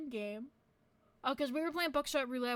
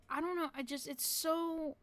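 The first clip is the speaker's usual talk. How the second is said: normal talking voice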